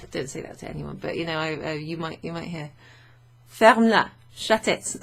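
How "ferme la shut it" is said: loud, higher short command; mock rude order. Teacher showing what you might hear, mock rude command. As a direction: shout it as a pretend rude order.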